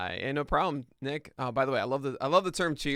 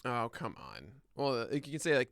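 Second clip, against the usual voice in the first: ordinary talk, nothing marked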